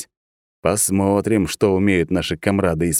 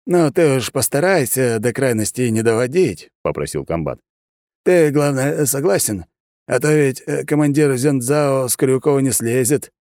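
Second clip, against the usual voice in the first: higher, pressing officer's voice; coaxing colonel; 7 semitones higher than usual. A narrator's reading. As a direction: talk him into the fight.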